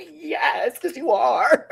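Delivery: laughing, loud and high; laughing retort. Real, not acted. Answering the teacher's complaint, laughing loudly. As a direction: crack up while shouting it back.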